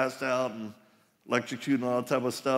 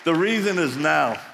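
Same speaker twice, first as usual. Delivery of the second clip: louder and higher, driving home the call; full-force preaching; 5 semitones higher, 3 dB louder than usual. Real, not acted. Pastor urging men to engage now, preaching hard. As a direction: preach it to the men like a rally cry.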